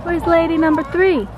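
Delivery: calling chickens. Real, not acted. Calling for missing hen.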